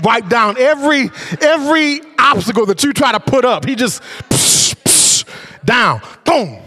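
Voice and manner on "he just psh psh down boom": psh boom. Acted. Mimics obstacles knocked down.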